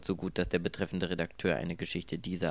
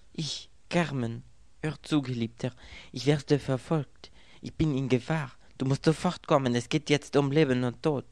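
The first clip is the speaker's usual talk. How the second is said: urgent foreign woman; 6 semitones higher, 4 dB louder than usual. A narrator's reading. Foreign woman urges lover on phone.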